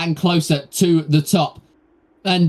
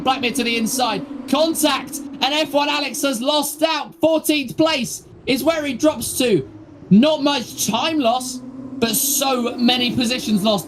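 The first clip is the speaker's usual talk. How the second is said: higher, rising race call at contact; excited commentary; 6 semitones higher than usual. Real, not acted. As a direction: call the crash with building excitement. Live race commentary of a crash.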